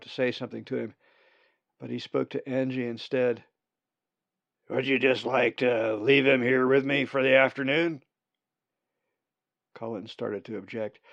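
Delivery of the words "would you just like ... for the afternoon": put-on old raven voice. Old raven Uriah asks.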